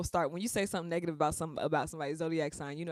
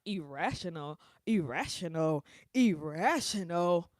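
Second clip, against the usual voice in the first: indignant echo, building louder each time; mock outrage; 4 dB louder, slower than usual. Imitating Virgos called irrational, in mounting mock outrage. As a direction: imitate someone affronted, stretching the last repeat.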